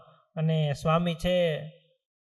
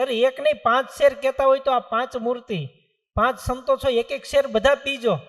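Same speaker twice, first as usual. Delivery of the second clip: higher and louder, gushing; eager offering; 6 semitones higher, 5 dB louder than usual. Acted. Family eagerly urging the five saints to drink. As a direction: press the milk on them eagerly.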